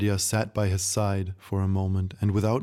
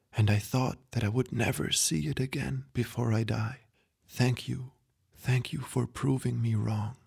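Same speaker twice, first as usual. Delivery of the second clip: fading grandpa thanking her gently; frail dying grandpa; 3 semitones higher than usual. Acted. Dying grandpa's last words.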